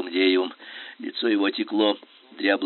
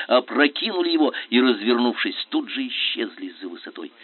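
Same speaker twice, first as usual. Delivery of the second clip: ordinary delivery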